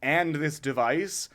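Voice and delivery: ordinary talk, nothing marked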